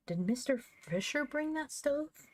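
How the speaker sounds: high, slow, wide-eyed question; wondering girl. Acted. Girl asking her mother about the stove, slow and high in wonder. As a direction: ask it slowly, high with wonder.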